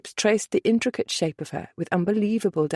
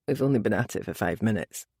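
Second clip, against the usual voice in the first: low gentle chuckle in the voice; gently amused; 9 semitones lower, 4 dB quieter, faster than usual. A narrator's reading. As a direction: soothe her, quietly amused. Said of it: "he said gently".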